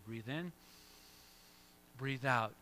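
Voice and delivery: soft, low guided breath cue; calm breathing cue. Shown as a demonstration. Pastor guides the congregation through a calm breath. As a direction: talk them gently through a breath. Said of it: "So you gotta pause, breathe in".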